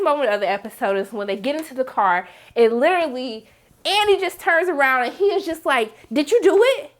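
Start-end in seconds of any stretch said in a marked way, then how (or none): 6.1-6.9 high, loud accusing question; sharp confrontation. Retold or imitated. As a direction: confront a son, imitating a father's outburst. Acts father confronting son in car.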